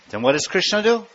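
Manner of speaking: normal talking voice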